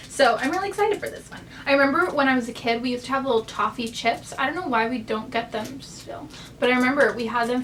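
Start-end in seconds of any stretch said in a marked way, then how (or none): none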